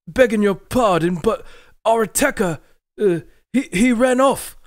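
high, loud voice of a nervous northern soldier; rough soldier Dirk. Acted. Dirk reports to his commander Morgrig, high anxious soldier voice. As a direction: answer your commander, anxious and eager.

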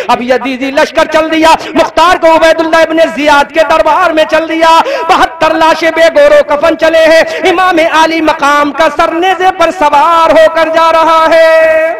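impassioned majlis lament; preaching at full force. Acted. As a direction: cry it out to the gathering. Preaching climax resumes.

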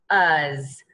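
"f, uz": f alone, then the rime; sounded out. Shown as a demonstration. Teacher reviews fuzz with one z, sounded out. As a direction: split "f, uz" before blending. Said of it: "only going to make one of those letter".

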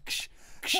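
voiceless hissed cap-pop, twice; imitated bottle opening. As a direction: make two bottle-opening noises with the mouth. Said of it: "he goes".

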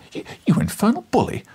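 high indignant stammer; crying out. Acted. Reporter crying at Challenger, high and outraged. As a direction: spit it out, outraged. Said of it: "I cried".

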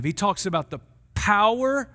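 normal talking voice

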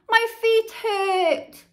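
high, slow whine. A narrator's reading. Henry whines to his parents on the walk, high and slow. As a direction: drag out the complaint like a tired kid. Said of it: "whined Henry".

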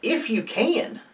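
high, slow, incredulous echo; Jesus, retorting. Retold or imitated. Jesus giving it right back to the father, incredulous retort. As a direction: toss it back softly, pitched right up. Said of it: "gives it right back to the father".

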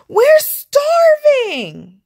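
high, loud, slow hungry whine; whiny hungry wail. Acted. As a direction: drag it out, starving and desperate. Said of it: "said his friends".

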